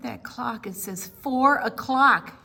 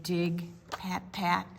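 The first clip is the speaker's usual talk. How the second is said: usual speaking voice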